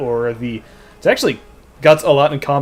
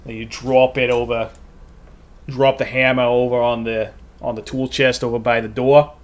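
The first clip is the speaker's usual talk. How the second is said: Maine accent. Shown as a demonstration. Streamer demos his Maine accent to chat. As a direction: talk like a blue-collar Maine worker. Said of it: "lapse into my Maine accent".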